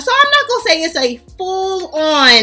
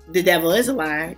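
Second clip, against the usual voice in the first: low, softer mock declaration; firm refusal, acted; 8 semitones lower, 6 dB quieter than usual. Viewer walking out the daycare door, firmly refusing in a low voice. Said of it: "you like".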